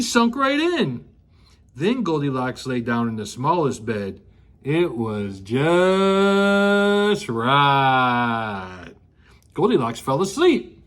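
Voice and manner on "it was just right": very slow, low and contented; drawn-out satisfied. Acted. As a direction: stretch it out like pure bliss. Goldilocks finds perfect bed.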